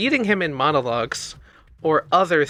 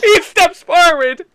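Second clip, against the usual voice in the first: laughing; 11 dB louder, slower than usual. Real, not acted. GM laughing while narrating.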